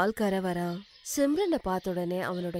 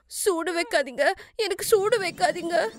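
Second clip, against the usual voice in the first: high-pitched terrified pleading; crying out; 8 semitones higher than usual. Acted. Daughter-in-law reliving the burning, crying out in fear. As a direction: cry out in panic at a remembered burning. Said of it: "Simran ஜோரா கத்துறா".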